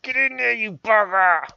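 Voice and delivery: high, loud yell at the game; loud high-pitched shout of frustration; mock shouted mantra. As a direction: bellow it at the stubborn piece. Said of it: "repeat the important mantra of".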